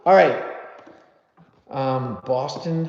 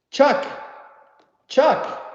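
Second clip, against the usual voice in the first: short, higher-pitched calls; calling out a name; 9 semitones higher than usual. Real, not acted. Host calling on a viewer in the chat, raised pitch. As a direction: call out for someone who isn't answering.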